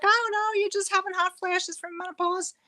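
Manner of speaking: high breezy voice of a friend reassuring her; imitating friend. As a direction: mimic the friend brushing it off. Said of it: "She's like".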